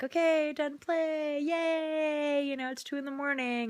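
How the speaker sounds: put-on high, bright, chirpy little-kid voice; a toddler cheerfully declaring playtime; imitating the toddler. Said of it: "my son is gonna wake up and be like".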